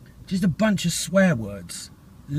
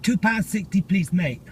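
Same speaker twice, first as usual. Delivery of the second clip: louder and higher, with a showy cashier twang; imitating the cashier's put-on accent; 4 semitones higher, 3 dB louder than usual. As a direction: repeat the price like the man at the till, in his put-on voice. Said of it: "a voice that sounds like that".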